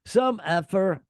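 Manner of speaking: hammed-up scolding of the crowd from the stage; imitating an annoyed singer telling off fans. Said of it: "[name] can be heard saying".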